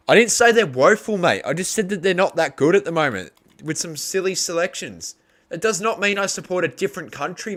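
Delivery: high, loud, heated telling-off; angry rebuttal. Real, not acted. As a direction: snap back hard at the viewer who accused him. Angrily rebutting a chat commenter.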